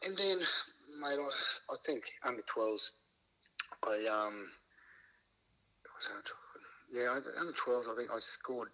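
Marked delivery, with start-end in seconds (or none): 6.0-6.6 a whispered aside to himself; unsure whispered self-question. Real, not acted. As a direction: drop to a breathy self-check.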